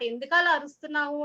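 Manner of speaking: normal talking voice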